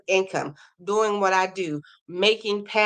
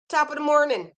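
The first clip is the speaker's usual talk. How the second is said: higher-pitched, playful fake Irish accent; amateur Irish lilt, pitched up; bad Irish accent; 6 semitones higher than usual. Shown as a demonstration. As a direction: attempt a stock Irish greeting, badly. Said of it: "forgive me for my bad Irish accent".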